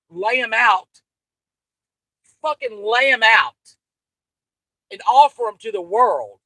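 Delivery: loud, furious rant; furious shout. Real, not acted. Ranting at Sam about her daughters, loud and furious. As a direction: hurl it at her enemy, loud.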